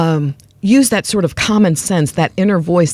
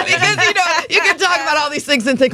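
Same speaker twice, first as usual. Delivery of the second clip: laughing; 10 semitones higher, faster than usual. Real, not acted. Laughing while explaining show.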